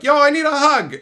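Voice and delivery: calling out. Retold or imitated. Calling out across office.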